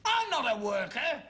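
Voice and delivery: high-pitched protest; indignant denial. Acted. Father denying son's class accusation, indignant outburst.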